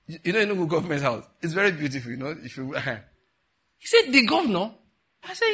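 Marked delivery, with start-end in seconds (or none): 4.1-4.7 amazed question. Retold or imitated. Guest amazed at the governor's house.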